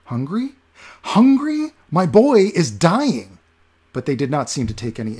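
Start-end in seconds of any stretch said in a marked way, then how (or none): 0.1-3.4 very high, louder, slow outburst; outraged shout. Acted. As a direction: explode at the crowd in disbelief. Olaf outraged at the beggars.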